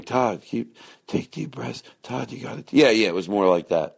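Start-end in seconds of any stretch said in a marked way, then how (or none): 0.0-2.6 imitating him softer and higher, soothing; softer imitation. Todd imitating Aristotle's gentle coaching, soft. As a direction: mimic Aristotle's calm coaching, quieter. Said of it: "It was more like you were, like, going".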